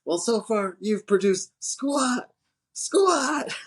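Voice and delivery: slow, high, contemptuous yell on squat; scornful boss. Retold or imitated. McGinley scorning Pappas for no results, scornful. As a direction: sneer the word squat at him.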